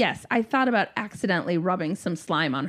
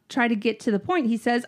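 ordinary talk, nothing marked

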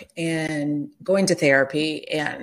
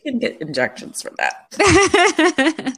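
much higher and slow, playful; mock advice; 12 semitones higher, 4 dB louder, slower than usual. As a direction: tease him with a helpful suggestion. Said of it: "I was like".

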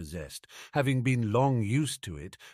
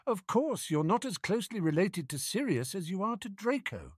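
high-pitched teasing smirk; 11 semitones higher, 3 dB quieter than usual. A narrator's reading. Harry teasing Ron about his relatives, smirking. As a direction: needle him lightly, pitched up.

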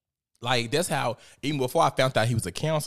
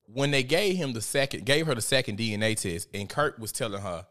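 normal talking voice

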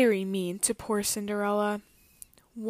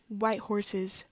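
ordinary delivery, nothing marked

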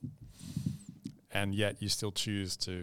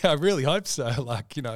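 laughing; 7 semitones higher, 8 dB louder than usual. Real, not acted. Laughing at own retort.

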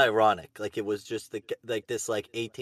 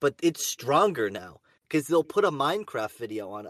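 ordinary talk, nothing marked

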